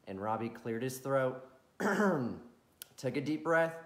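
put-on gentle storyteller reading to kids; bedtime story narration. A narrator's reading.

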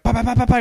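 loud, high volley of bangs; imitated banging. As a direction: mimic frantic pounding on glass. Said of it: "She's banging on the window".

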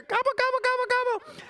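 high-pitched turkey gobbling; rapid squeaky turkey imitation; turkey gobble. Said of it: "You can do a turkey there?".

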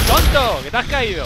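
loud teasing yell; mocking shout. Real, not acted. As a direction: shout at him for falling. Mocking a fallen player.

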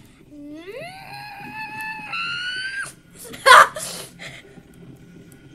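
high, shrieking laughter of relief; screaming laugh. Real, not acted. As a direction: laugh wildly, almost screaming. Relief after near death.

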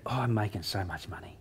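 soft, whispered brag; whispered boast. Retold or imitated. Brother boasting to dad, in a whisper. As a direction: confide it under your breath. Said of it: "Dean said to my dad".

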